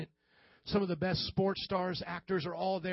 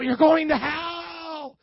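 high, loud, long-drawn mock yell; hammed-up street preacher's cry; street-corner yelling; 7 semitones higher, 6 dB louder, much slower than usual. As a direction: holler it down the street, stretching the last word. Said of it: "yelling at people".

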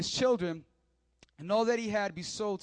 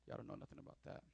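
breathy joking whisper under the breath; whispered aside; 6 semitones lower, 20 dB quieter, faster than usual. Real, not acted. Pastor teasing the church about tithing, whispered. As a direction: let it slip out as a sly whisper. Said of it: "whispered aside about tithing".

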